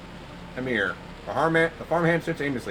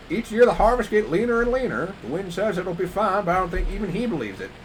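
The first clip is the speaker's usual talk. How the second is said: farmhand voice; 6 semitones higher than usual. Acted.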